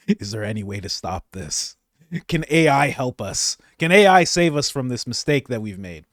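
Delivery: ordinary talk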